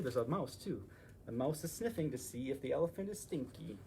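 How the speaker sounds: ordinary delivery, nothing marked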